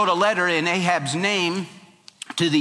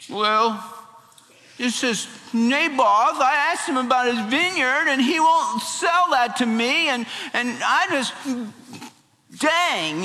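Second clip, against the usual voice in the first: whining; 9 semitones higher than usual. Acted. Pouting king complains to his wife about the vineyard, whining. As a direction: whine like a pouting three-year-old. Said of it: "he's whining like a whipped hound".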